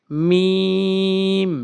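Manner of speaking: মিম with the m held long; মিম pronounced slowly with long nasal hum; drawn-out meem. Shown as a demonstration. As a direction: stretch মিম slowly, lips closed. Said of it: "টেনে পড়ব".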